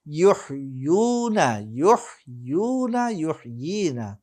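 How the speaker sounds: lilting conjugation drill; model recitation. Shown as a demonstration. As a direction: hold each long vowel clearly for students. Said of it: "উচ্চারণটাকে খুব ভালোভাবে লক্ষ্য করতে হবে".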